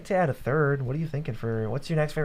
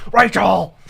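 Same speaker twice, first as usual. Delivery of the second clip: Batman shout; 5 semitones higher, 10 dB louder than usual. Acted. Batman quote shouting for Rachel.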